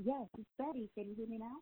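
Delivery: muffled, distant bad phone line; faint caller on a bad Bluetooth line; in a tunnel. Real, not acted. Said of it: "sounds like you're in a tunnel".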